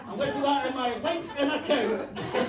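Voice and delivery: ordinary talk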